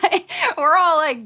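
ordinary talk, nothing marked

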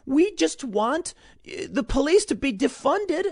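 high, slow, whiny parody of a protester; mock protester voice. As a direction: parody a whiny activist, drawn out. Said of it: "The protesters are gonna be like".

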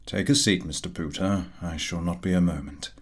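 measured, formal boss receiving his clerk; lightly voiced employer. Employer Perkupp calls Pooter in.

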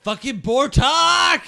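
slow, high, drawn-out yell; acted furious holler; enraged shout. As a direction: curse the escaped villain loudly. Frustrated yell after villain escapes.

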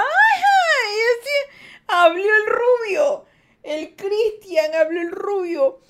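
very high, loud, slow sarcastic drawl; mock him in a sneering high voice; high, slow teasing scoff; mocking sarcasm. Mocking a chatter who claims American.

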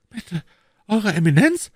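ordinary delivery, nothing marked

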